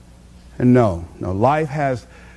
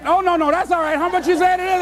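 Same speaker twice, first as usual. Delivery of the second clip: laughing; 9 semitones higher, faster than usual. Retold or imitated. Customer eager to end it.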